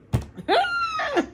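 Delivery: squealing laugh, way up high; piercing giggle; shrill high laugh. Real, not acted. Laughing at own joke.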